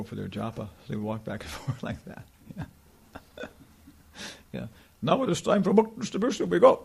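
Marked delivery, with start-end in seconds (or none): 5.0-6.8 a high, clipped German devotee calling time; mock the rigid German devotee, pitched up. Mocking the strict German devotee, a high imitating voice.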